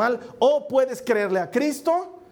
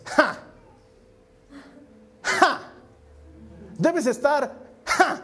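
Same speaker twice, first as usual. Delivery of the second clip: sarcastic laugh; 6 semitones higher, much slower than usual. Real, not acted. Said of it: "mocking laugh at God".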